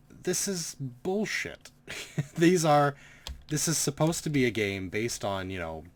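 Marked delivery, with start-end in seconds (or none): 0.1-4.4 can't believe this nonsense; exasperated disbelief. Real, not acted. Ranting at the game's unfair outcome.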